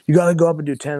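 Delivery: urging friends. Retold or imitated. Friends urging him onstage, acted quote.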